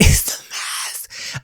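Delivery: breathy laughing whisper of "It's a mess"; half-crying, half-laughing whisper. Real, not acted. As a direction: laugh it out under the breath, slowly. Laughing repeat of her own line.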